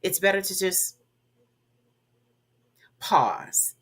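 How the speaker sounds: long silent pause after "just", then "pause"; a deliberate silent beat before "pause" in place of a filler; pregnant pause. Shown as a demonstration. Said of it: "That's what I just did".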